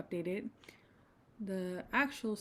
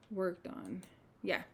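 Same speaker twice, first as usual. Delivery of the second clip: ordinary delivery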